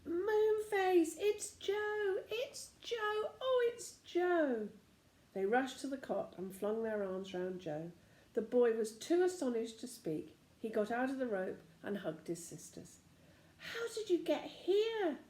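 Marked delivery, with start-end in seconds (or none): 0.1-4.7 very high, excited cries of recognition; joyful yelling. A narrator's reading. Sisters recognising their brother Joe, yelling with joy. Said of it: "yelled out loudly". 13.6-15.2 high, slow astonished question. A narrator's reading. Joe astonished to find his sisters, high and slow.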